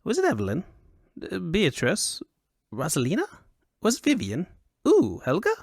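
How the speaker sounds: eager high-pitched guessing, each name a question; animated foppish questioning; high Elvunian fop. A narrator's reading. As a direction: play a curious dandy trying out guesses. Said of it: "he said in a high Elvunian accent".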